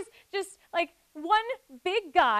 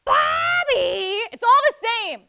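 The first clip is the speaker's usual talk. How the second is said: high, loud wailing call of Bobby; King of the Hill mom; 6 semitones higher, 9 dB louder, much slower than usual. Shown as a demonstration. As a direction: cry out the name in exasperation. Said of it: "Give me another one".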